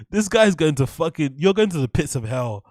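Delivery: ordinary delivery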